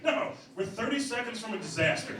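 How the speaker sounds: acted desperate urgency; urgent plea. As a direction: plead for an emergency stop. Urgent plea to pull over.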